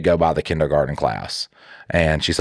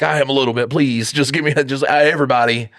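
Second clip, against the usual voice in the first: high pleading; 7 semitones higher than usual. Retold or imitated. Pleading to pay for all.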